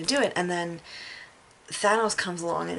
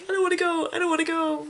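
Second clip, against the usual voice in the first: high, louder pleading; 11 semitones higher, 4 dB louder, faster than usual. Retold or imitated. Spider-Man dying in Tony's arms, pleading. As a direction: beg not to go, imitating a scared kid. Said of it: "He's like".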